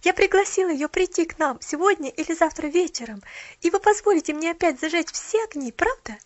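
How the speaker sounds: high, eager rushed asking; breathless pleading. A narrator's reading. Girl begging Mrs Carew, breathless. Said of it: "говорила, не переводя дыхания".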